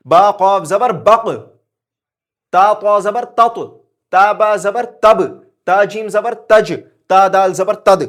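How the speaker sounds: loud, slow spelling, with each last letter bounced; loud slow qalqala drill. Shown as a demonstration. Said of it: "ایسے کرو". (بلند آواز میں آہستہ قلقلہ کی مشق)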